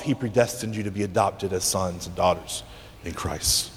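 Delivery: ordinary delivery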